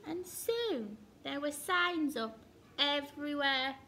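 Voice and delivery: usual speaking voice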